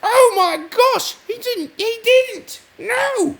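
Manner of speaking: very high, loud gasping voice; shocked courtiers. Retold or imitated. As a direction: shriek it like scandalized courtiers. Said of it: "Everyone in the court was like".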